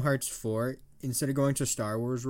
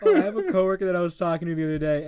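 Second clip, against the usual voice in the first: louder, laughing through the words; laughing while talking; 7 semitones higher, 6 dB louder than usual. Real, not acted. Starting a work story to a friend while still laughing.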